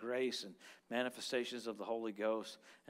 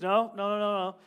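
insistent denial; 6 semitones higher, 9 dB louder than usual. Retold or imitated. Woman refusing the called-out word.

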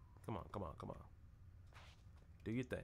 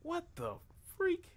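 disbelieving shout; slower than usual. Real, not acted. Player shocked the character will marry her. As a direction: squeal it in disbelief.

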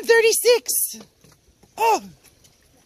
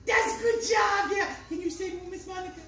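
put-on kids show host; 8 dB quieter than usual. Talking to kids watching.

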